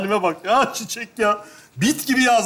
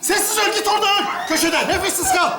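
exasperated scolding; 6 semitones higher than usual. Acted. Acted quarrel with tent partner.

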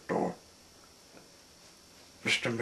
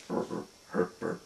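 usual speaking voice

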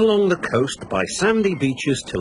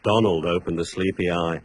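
usual speaking voice

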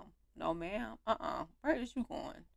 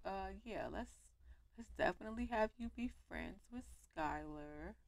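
normal talking voice

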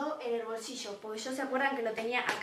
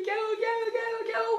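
high, fast, panicked repeats; 9 semitones higher, much faster than usual. Retold or imitated. Her inner panic while being chased, high and frantic. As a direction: freak out in your head.

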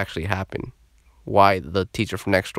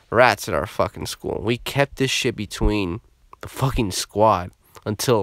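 usual speaking voice

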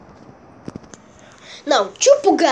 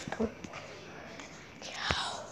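breathy whisper so nobody wakes; hushed sign-off; whispered goodbye; 21 dB quieter than usual. Real, not acted. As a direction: whisper a quick bye. Dad asleep, signing off.

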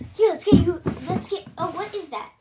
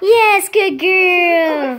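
slow, louder stretched praise; drawn-out praise; 2 semitones higher, 7 dB louder, much slower than usual. Real, not acted. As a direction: reward the dog with long praise. Praising the dog.

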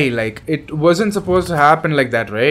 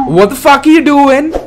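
mock outrage; 9 semitones higher, 3 dB louder than usual. Scolding viewers who haven't subscribed.

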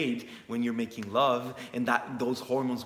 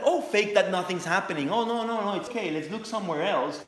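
mock denial; 5 semitones higher than usual. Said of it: "we try to, like".

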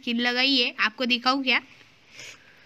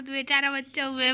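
ordinary talk, nothing marked

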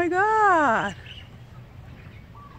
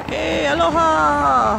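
called-out greeting; 6 dB louder, slower than usual. Real, not acted. Greeting someone at the ranch.

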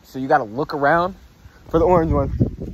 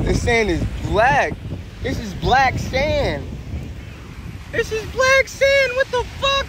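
amazed; 13 semitones higher, slower than usual. Real, not acted.

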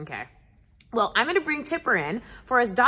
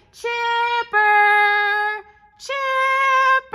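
high, loud call for the dog; sing-song call across the yard; 9 semitones higher, 5 dB louder, slower than usual. Pretend. Host calling her dog Chipper in from the sandbox, loud and high. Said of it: "Okay, ready?".

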